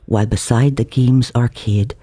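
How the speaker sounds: usual speaking voice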